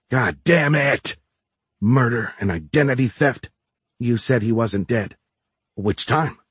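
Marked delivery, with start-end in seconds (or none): 0.1-1.2 slow emphatic curse. Acted. Cursing louder, slowly.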